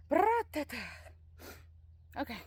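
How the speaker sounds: slightly breathless after work; out of breath. Real, not acted. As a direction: talk while catching breath. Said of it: "I'm a little out of breath".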